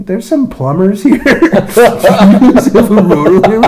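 high mock voice of the woman on the radio, laughing; imitating a confused radio call; mocking falsetto. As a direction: ham up a clueless caller, slow.

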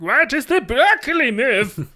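high, slow pedantic nerd voice; squeaky know-it-all complaint; nerdy whine. Acted. Nerd quote voice.

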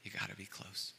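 very soft, close to the mic; hushed. Shown as a demonstration. Preacher to congregation, softly and intimately. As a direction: lean in and say it softly. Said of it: "in order to hear a whisper".

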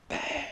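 high, soft stunned bah. Retold or imitated. Vlogger dumbfounded by the kid, a high soft bah. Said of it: "I was like".

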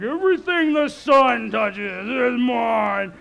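slow, booming king's proclamation; Mufasa. Retold or imitated. Dad channelling the lion king at his daughter, booming. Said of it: "I'm feeling like Mufasa".

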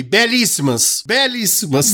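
slow, rising fake gush; mock enthusiasm. Giving in to the taxi driver with mock enthusiasm. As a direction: give him what he wants, hammed up. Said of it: "eu falei".

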